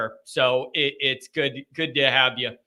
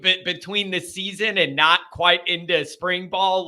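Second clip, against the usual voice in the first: ordinary delivery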